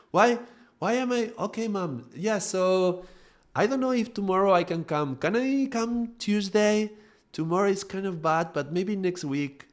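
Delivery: put-on very high, childlike voice; high-pitched, submissive. Grown child making plans with mother, high submissive voice. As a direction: go into child mode, pitched right up. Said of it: "my voice goes higher".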